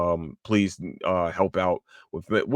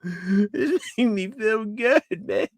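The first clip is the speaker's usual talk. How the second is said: overwhelmed fan wailing; 12 semitones higher, 6 dB louder than usual. Pretend. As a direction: whine and howl as if it's unbearable to wait. Overcome by impatient excitement, apologises after.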